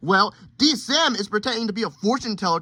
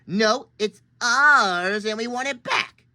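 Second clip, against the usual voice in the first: stubborn drawn-out reply; slower than usual. Acted. Drawn-out childish argument.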